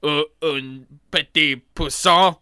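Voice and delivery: put-on slow, loud French accent; drawn-out hammy French shopkeeper; french chef. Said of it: "they're like".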